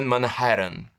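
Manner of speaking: "Manhattan" with the Ts softened to a tap. Shown as a demonstration. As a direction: say "Manhattan" affectedly, dropping the hard Ts. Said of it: "fala Manhattan sem pronunciar os T's". ("Manhattan" sem pronunciar os T's, "manrréran")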